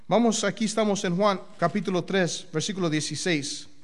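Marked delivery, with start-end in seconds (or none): none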